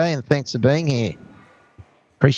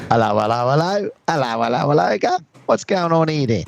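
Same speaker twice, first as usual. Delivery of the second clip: mock British bobby; 4 semitones higher than usual.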